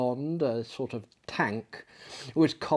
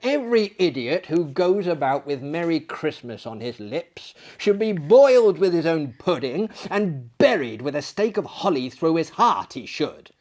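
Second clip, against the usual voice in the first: louder, higher indignant outburst; 5 semitones higher, 7 dB louder than usual. A narrator's reading. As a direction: bristle with outrage at the idea. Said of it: "said Scrooge indignantly".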